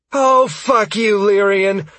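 high, loud, drawn-out fury; furious curse. A narrator's reading. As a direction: hurl the curse mid-battle. Sierra curses as the horde nears.

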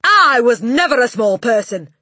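high, very loud indignant outburst; snapping Trunchbull. A narrator's reading. Headmistress answering a pupil's question, indignant shout. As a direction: snap back at full volume. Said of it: "she snapped".

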